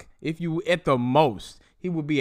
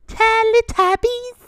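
much higher, slow, stretched question; incredulous repeat; 6 dB louder, slower than usual. Real, not acted. Laughing at his own Teletubby insult, high stretched squeal. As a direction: squeal it in disbelief.

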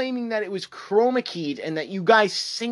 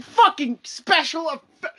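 high exasperated rant, raised voice; mock exasperation; 8 semitones higher than usual. Ranting to viewers about the article, exasperated mock outburst. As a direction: squeal the phrase in disbelief. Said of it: "I'm like".

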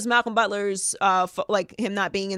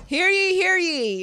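slow, loud, raised proclamation; town crier; 11 semitones higher, 5 dB louder, slower than usual. Pretend. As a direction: announce it like a royal herald. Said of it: "I'm Princess Megan".

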